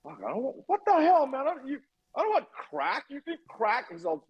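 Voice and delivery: high, rushed and alarmed; freaked-out protest. Retold or imitated. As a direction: panic at being offered crack. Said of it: "And I'm like".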